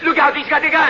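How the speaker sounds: sudden high warning cry; panicked shout of alarm. Acted. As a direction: yell the warning as the gun comes out. Gunfight breaks out, alarm.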